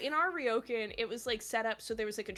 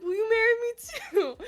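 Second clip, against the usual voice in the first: laughing high; 12 semitones higher, 9 dB louder, faster than usual. Retold or imitated. Laughing, high, retold proposal.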